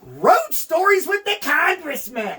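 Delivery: high, slow show-host announcement; announcer. Acted. Host to listeners, slow high announcer voice. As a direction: announce the segment.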